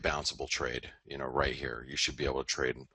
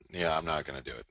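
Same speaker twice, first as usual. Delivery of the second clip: normal talking voice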